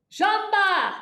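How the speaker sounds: "Jamba!" barked high and loud from the belly; stage yell. Shown as a demonstration. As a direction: punch "Jamba!" out with the abs. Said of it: "This is what we do for stage yelling".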